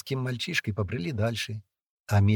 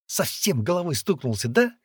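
put-on indignant girl's higher voice; 8 semitones higher, 4 dB louder than usual. A narrator's reading. Girl to the sleepy boy, indignant. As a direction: scold him in pitched-up disbelief. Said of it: "возмутилась девчонка".

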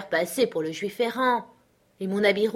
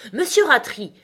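loud, high warning exclamation; alarmed cry; 5 semitones higher, 7 dB louder than usual. A narrator's reading. Suzeran hushes Rattray before a secret slips out, urgent cry. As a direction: cry out to cut him off. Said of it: "s'écria Suzeran en mettant un doigt".

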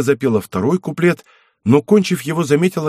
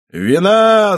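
loud, high tipsy shout for wine; 9 semitones higher, 5 dB louder than usual. A narrator's reading. Tipsy host Zych shouting loudly for wine. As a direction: bellow for wine, merry and carried away. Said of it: "закричал расходившийся владелец".

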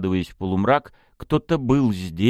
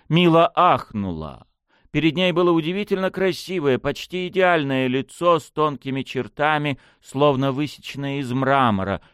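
ordinary delivery

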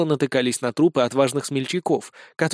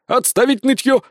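high, loud sergeant's command; exclaimed; 10 semitones higher, 5 dB louder than usual. Acted. Sergeant stops his squad's whining, exclaiming loudly. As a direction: call the squad to order briskly. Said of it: "воскликнул Сисюля".

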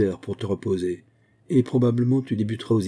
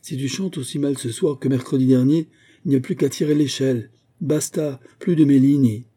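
higher-pitched scolding, rising to a loud finish; bad-tempered; 6 semitones higher, 5 dB louder than usual. A narrator's reading. Teacher warns his pupil before the performance, bad-tempered. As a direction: threaten in mild narrated ill humour. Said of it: "avec autant de mauvaise humeur".

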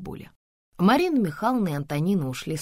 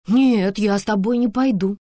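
higher, loud, alarmed protest; frightened refusal; 6 semitones higher, 7 dB louder, slower than usual. A narrator's reading. Antonina balking at shopping with her friend, frightened. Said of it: "опять испугалась Антонина".

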